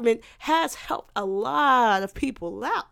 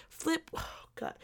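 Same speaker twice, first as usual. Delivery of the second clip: whispered aside; 6 semitones higher, 10 dB quieter than usual. Real, not acted. Exasperated aside.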